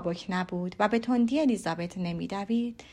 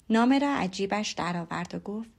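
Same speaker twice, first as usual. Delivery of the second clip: ordinary delivery, nothing marked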